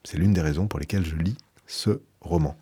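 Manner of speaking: ordinary delivery, nothing marked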